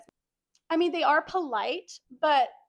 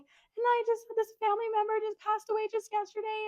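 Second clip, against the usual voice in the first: mock crying patient; 9 semitones higher, 4 dB quieter than usual. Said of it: "she's just crying about it".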